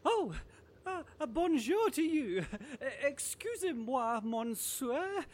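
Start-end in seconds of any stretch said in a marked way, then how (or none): 3.0-5.2 butchered French. Acted. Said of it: "please stop butchering my language".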